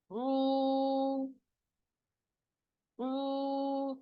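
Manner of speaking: ऊ held long for two counts, soft; stretched oo. Shown as a demonstration. Teacher models the two-count ऊ for the class. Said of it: "इसको टू count stretch करेंगे".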